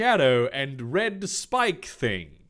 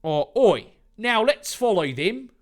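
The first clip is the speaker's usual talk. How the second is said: put-on a louder Australian accent, starting with oi; [name]'s order with an Australian oi; 7 semitones higher, 4 dB louder than usual. [name] ordering everyone to follow Dave, in an Australian accent. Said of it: "it's Australia".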